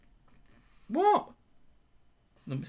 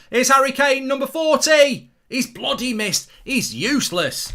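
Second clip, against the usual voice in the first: loud, higher frustrated shout at the telly; real loud, higher outburst at a striker; excited shout; 6 semitones higher, 8 dB louder than usual. Real, not acted. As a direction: yell at the screen over the miss. Reacting to a missed chance.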